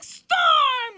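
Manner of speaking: high, loud call of the surname; hammed-up exasperated shout; mock angry yell. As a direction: act out yelling at an annoying rival. Retold angry call to rival.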